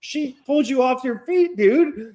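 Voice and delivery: high-pitched imitation of an astonished friend; retold amazement. Friend reacting to the fight, high mock astonishment. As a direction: play the amazed witness, pitch well up.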